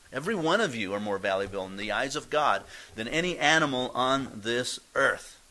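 ordinary talk

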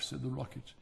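plain reading voice

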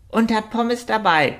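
hoch und aufgeregt, wie eine Ente; hohe, quakende Entenstimme voller Aufregung; aufgeregt quakend. A narrator's reading. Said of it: "quakte Richard aufgeregt". (aufgeregtes Quaken)

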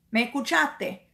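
ordinary talk

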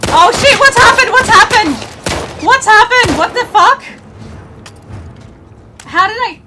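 high, loud panicked yelling; being chased. Real, not acted. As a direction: scream it in a frantic panic.

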